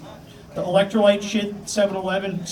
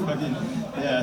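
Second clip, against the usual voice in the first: ordinary delivery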